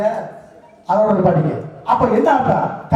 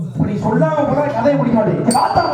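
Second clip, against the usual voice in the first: usual speaking voice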